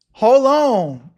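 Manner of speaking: ordinary talk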